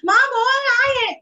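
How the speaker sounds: put-on high, loud crying child voice; imitating child crying. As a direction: cry it out like a frustrated child. (रोते बच्चे की नकल, ऊँची और तेज़ आवाज़ में)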